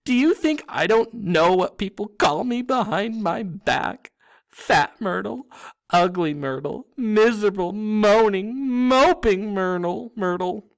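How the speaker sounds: high, loud, distraught wail; wounded outburst. A narrator's reading. Girl ghost throws insults she's heard back at them, wailing. Tearful ghost lists her cruel nicknames.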